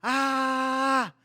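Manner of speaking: long, high-pitched "aaah"; sustained mock scream on one note; acted crowd yell. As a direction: act out everyone shouting. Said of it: "porque me toca actuar".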